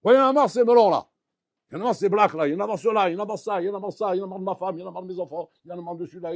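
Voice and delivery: loud, high-pitched fed-up griping; ranting grumbler. Acted. Rabbi imitating a man who grumbles all day, ranting. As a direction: rattle off complaints, starting at full blast and easing down. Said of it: "tu râles comme lui".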